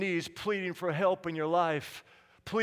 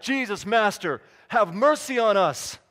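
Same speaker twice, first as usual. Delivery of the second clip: loud, raised preacher's cry for mercy; imitated yelling; 3 semitones higher, 7 dB louder than usual. Lepers yelling out to Jesus for help, imitated loud plea. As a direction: call out as if begging from a distance. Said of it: "Can you hear them yelling out".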